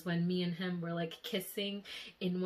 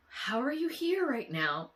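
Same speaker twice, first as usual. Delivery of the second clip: mock-stunned question, higher and louder; retold shock; 5 semitones higher, 5 dB louder than usual. Asking him how he is here, retold shock. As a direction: relive the disbelief, pushing the question up and out. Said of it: "I was so shook".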